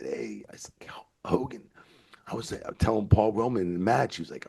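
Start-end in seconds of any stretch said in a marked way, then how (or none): 0.0-1.6 whispered call to a colleague; hushed aside. Retold or imitated. Telling the boys backstage about a star, hushed. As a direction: get his attention under your breath.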